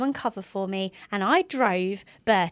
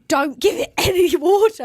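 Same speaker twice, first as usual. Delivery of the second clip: urgent warning; 9 semitones higher, 8 dB louder than usual. Retold or imitated. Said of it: "the wildlife aid were like".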